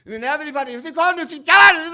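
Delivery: put-on high, loud, strange accented shriek; Udo Kier impression. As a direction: ham it up as an eccentric European actor. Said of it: "it opens with Udo Kier going".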